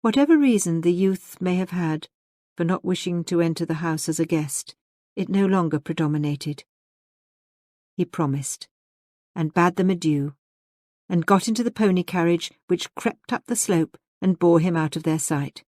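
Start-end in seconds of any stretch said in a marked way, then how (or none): none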